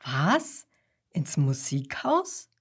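low, puzzled Papa Koala voice; taken-aback dad, dropped voice with questioning lift; astonished father. Acted. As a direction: question it in low-pitched disbelief. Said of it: "schaut erstaunt".

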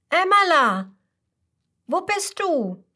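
calling out high and loud for a lost friend. Acted. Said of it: "ruft er ihren Namen noch lauter".

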